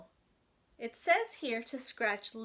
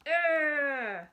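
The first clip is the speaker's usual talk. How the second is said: groan; 4 semitones higher, 7 dB louder than usual. Acted. Strained groan.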